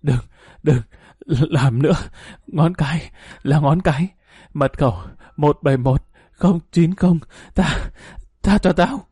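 weak, gasping plea; last breath. A narrator's reading. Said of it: "Luật thều thào".

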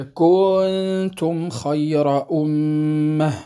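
كنتم خير أمة said slowly with كن stretched; added elongation. Shown as a demonstration. Teacher showing an error, stretching كن slowly. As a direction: drag out كن as an unintended madd. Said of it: "مد بدون أن يشعر".

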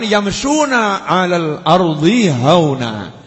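Arabic verse drawn out very slowly; slow chanted Quran phrase; slow Quranic recitation. Acted. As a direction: intone it slowly like tilawah. Verse recited again mid-sermon.

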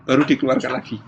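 ordinary talk, nothing marked

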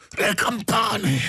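high, drawn-out scream without extra volume; terrible scream. A narrator's reading. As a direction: screech it slowly, pitch far up. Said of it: "urlò il pazzo con voce terribile".

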